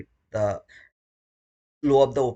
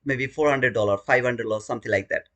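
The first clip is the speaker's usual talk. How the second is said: ordinary delivery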